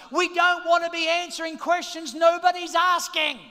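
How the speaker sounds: rally the church at full volume; full-force preaching. Real, not acted. Pastor fires up the congregation about going wide, shouting.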